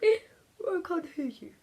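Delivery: slow, high, half-whispered reply; William's muffled reply. Acted. William answering through marshmallow earplugs, slow and high. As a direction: sound like a boy with his ears plugged.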